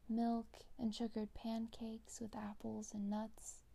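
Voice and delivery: ordinary delivery, nothing marked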